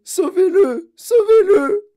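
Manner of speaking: supplier, aigu et fort, au bord des larmes; cri de mère affolée. Acted. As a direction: crier au secours, voix qui se brise. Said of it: "mère crie au secours". (mère paniquée, voix aiguë qui crie)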